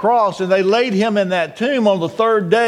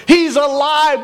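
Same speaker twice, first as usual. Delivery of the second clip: high, raised proclamation; exultant cry; 7 semitones higher than usual. Acted. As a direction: cry it out to rally the congregation. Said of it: "we can scream that this morning".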